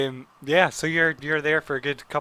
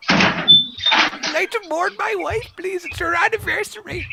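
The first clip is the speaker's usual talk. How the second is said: put-on higher, slow mournful old man; 3 dB louder, slower than usual. Woodworker mourns wife.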